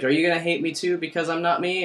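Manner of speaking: high-pitched, put-on hurt question; mock pleading. Retelling you asking sad Rin, mock pleading.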